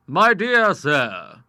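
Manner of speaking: very slow, polished and courtly; lawyer. A narrator's reading. MacAdam the lawyer welcoming the visitors, slow and courtly. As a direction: savour the greeting.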